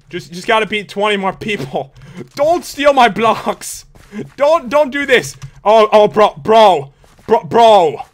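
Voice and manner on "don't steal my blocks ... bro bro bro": indignant, drawn-out pleading, getting louder; loud protest. Real, not acted. Gamer to players stealing blocks, loudly protesting. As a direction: call out in dismay at a rival.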